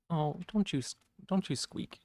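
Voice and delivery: high cooing scold. Real, not acted. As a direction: coo at a small pet. Talking to her pet.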